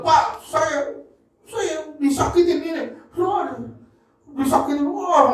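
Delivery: high-pitched mock weepy voice; imitated tearful complaint. Hurt churchgoer coming to the pastor, mock tearful complaint. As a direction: lightly imitate a tearful plea. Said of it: "Dia datang, dia nangis".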